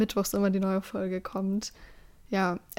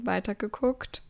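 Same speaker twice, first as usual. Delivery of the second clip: usual speaking voice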